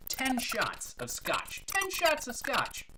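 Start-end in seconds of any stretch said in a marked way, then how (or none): 1.7-2.8 high, slow exclamation of disbelief; incredulous repeat. Acted. Bartender telling of a customer's huge order, incredulous. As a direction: stretch it out, astonished by the order.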